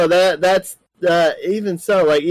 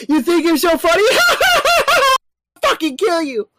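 high mocking threat; 13 semitones higher than usual. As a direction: sneer it out in a high voice. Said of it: "he was like".

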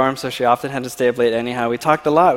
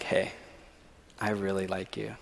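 hushed confession; 6 semitones lower, 15 dB quieter than usual. Retold or imitated. Confessing feelings, hushed quote.

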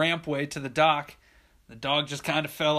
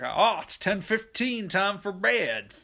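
put-on slow, higher old-timer voice; imitated grandpa; 4 semitones higher, slower than usual. Grandpa turning in for the night, slow drawn-out announcement. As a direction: draw it out as grandpa announcing bedtime. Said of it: "Grandpa comes up. He's like".

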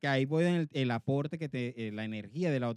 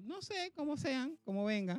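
careless shrug; 10 semitones higher, 7 dB quieter than usual. Retold or imitated. Telling his cohost how he answered on TikTok, with a high careless shrug. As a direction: toss it away like nothing matters. Own shrugging reply retold.

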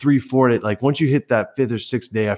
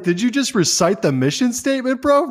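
mocking disbelief; 10 semitones higher than usual. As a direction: tease the supervisor, high. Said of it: "It's like".

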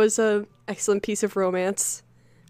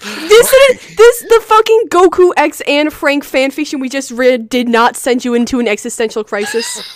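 mock outrage; 3 semitones higher, 7 dB louder, slower than usual. Real, not acted.

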